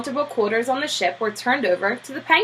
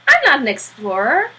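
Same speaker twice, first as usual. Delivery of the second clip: astonished Popper; 10 semitones higher, 4 dB louder than usual. Acted.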